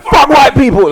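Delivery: high, loud mocking shout; mock sound bite yell. Mocking the interviewer's wished-for sound bite, high and loud. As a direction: yell it like the clip they want. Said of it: "wanna get a one sound bite".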